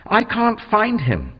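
high, urgent cry. Acted. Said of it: "Mary said urgently".

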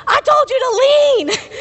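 high, loud panicked yell over the wind; retold yelling. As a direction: shout back at a passenger who won't lean. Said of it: "yelling at me".

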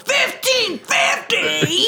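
hammed-up stunned customer; mock outrage. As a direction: drag out the price in disbelief. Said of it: "And he's like".

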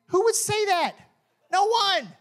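high, loud rhetorical question; incredulous. Real, not acted. Pastor asking the congregation, high and amazed. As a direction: ask it, then answer with disbelief.

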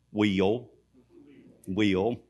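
"wheel" said apart, low and soft, gliding into the l; can't help saying "wheel" for will; drawn-out WHEEL for will. Real, not acted. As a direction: say "wheel" for will, with a diphthong glide. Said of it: "There's not a diphthong there".

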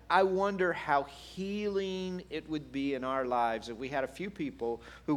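normal talking voice

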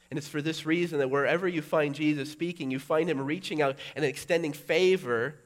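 loud, high preaching; fervent preaching. Acted. Preacher urging the congregation, loud and high. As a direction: preach it with rising fire.

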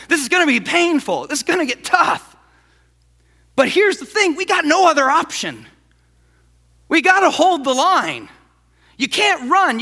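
rally frightened soldiers like Wallace before battle; rallying battle speech. Retold or imitated. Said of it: "he goes".

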